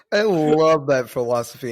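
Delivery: loud, heavily sarcastic praise. Real, not acted. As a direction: pile on fake enthusiasm. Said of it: "I'm being so, so, so sarcastic".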